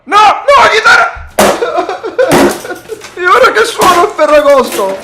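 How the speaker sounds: high, loud half-crying wail; anguished wailing. Real, not acted. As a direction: wail over the broken guitar. Guitar smashed, grandson wails.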